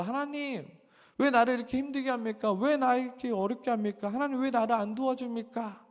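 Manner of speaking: high, urgent plea to God; re-enacted cry of grievance; resentful complaint. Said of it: "원망하고 불평했던 적도 많습니다".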